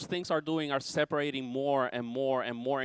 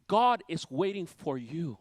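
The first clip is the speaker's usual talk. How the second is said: ordinary talk, nothing marked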